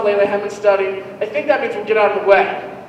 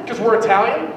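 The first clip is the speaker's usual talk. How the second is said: normal talking voice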